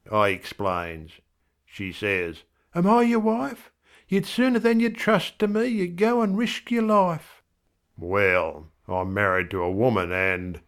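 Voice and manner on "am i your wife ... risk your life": much higher wife voice; an indignant wife, higher; wife's voice. A narrator's reading. Wife scolding husband Bill, much higher.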